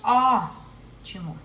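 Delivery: teacher modelling "Ótimo" slowly, syllable by syllable; stress on Ó. Shown as a demonstration. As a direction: draw out "Ótimo" and hit "Ó" hard. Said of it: "é minha sílaba tônica".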